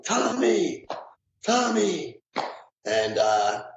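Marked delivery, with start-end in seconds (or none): 0.0-2.2 high, falling call for a missing man; imitated yelling. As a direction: call out the name high, at only moderate volume. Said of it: "this lone firefighter yelling".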